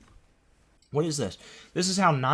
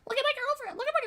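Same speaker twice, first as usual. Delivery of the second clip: very high squeaky mock of a proud boyfriend; mocking gushing boyfriend; much faster than usual. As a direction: ham up a boyfriend showing off his girlfriend. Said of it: "on Twitter and being like".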